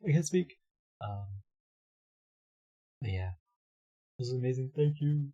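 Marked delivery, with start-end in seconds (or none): 0.1-0.6 high, loud mock gasp of shock. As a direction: play it stunned, voice jumping up. Playing shocked.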